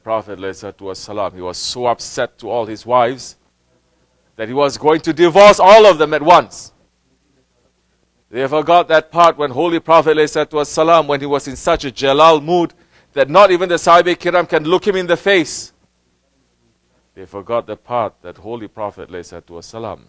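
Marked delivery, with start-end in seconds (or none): 4.4-15.7 loud, high impassioned sermon; preaching at full force. Acted. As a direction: preach it out to the crowd. Preaching with raised voice.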